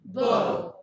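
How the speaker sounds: Book said loud, stopped on the rounded vowel; teacher sustaining Book; held vowel. Shown as a demonstration. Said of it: "but stop on the vowel".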